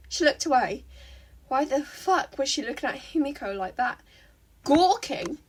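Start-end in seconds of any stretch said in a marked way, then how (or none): none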